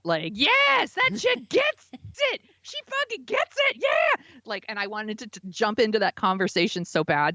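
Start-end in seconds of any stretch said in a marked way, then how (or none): 0.3-4.2 high, excited yell of agreement. Retold or imitated. Yelling at a podcast she agrees with, excited and high. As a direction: cheer at the podcast like a fan. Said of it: "yelling at the podcast".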